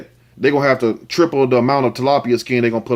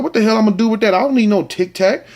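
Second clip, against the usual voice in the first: mock outraged disbelief; 7 semitones higher than usual.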